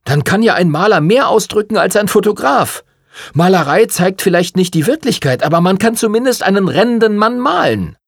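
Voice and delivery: heated, high-pitched outburst; blurting out. A narrator's reading. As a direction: burst out with a pent-up counterargument. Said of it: "Dann platzte er heraus".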